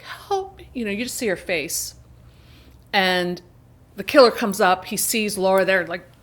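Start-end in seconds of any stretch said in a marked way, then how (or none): 0.0-0.7 high and faint, a buried woman's plea; a thin, breathy call from the ground; weak plea for help. Retold or imitated. Buried woman faintly begging the killer's wife's rescuer. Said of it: "the woman's like".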